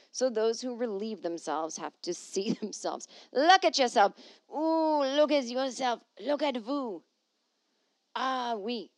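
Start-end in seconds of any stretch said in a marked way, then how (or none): 3.3-4.1 loud, higher scold at a peeing man; mock mirror scolding. The mirror scolds a man peeing in the street, loud. Mirror speaking to public pee-er. 4.5-8.9 higher, slow teasing with a hint of French; mock French tease. The mirror teases a man peeing in the street, in mock French.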